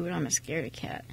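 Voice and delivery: whispered confession. Acted. Mechanic admitting to the waitress he is scared, whispered. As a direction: drop to a whisper, a bit sheepish.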